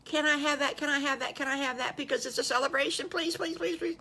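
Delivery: put-on a little boy begging over and over; begging little wolf.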